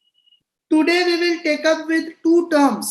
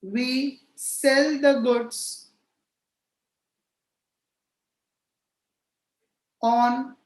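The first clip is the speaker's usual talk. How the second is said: slow, spaced-out dictation with long pauses; 2 semitones lower, slower than usual. Real, not acted. Teacher dictating a definition to students for notebooks, slow dictation. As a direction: dictate phrase by phrase, leaving time to write.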